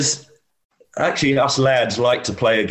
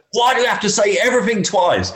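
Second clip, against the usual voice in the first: much higher, imitated outburst; exasperated repeat; 12 semitones higher than usual. Player tricked into repeating himself to caddy, mock exasperated. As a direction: repeat the gripe as a higher, more worked-up mock complaint. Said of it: "he's literally in pieces".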